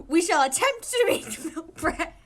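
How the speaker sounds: slow, loud, high pompous decree; mock grandeur. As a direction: proclaim it like a royal edict.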